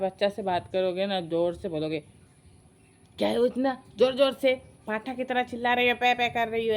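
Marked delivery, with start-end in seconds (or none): 3.2-6.7 quick, higher-pitched mock telling-off; imitated grandmother's scolding. Grandmother tells off a child for talking loudly, imitated scolding. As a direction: rattle off a mock complaint, pitch raised. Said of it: "ऐसे बोलेंगे".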